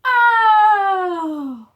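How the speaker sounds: very high, loud gasp of surprise. Shown as a demonstration. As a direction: gasp it like the whole crowd is shocked. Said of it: "Do it with me".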